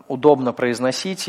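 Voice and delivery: normal talking voice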